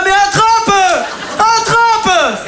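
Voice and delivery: high, slow, pleading shout; will the kid to grab it from the sidelines; hammed-up desperate father yelling; urgent cheering dad. Dad urging kid on ride.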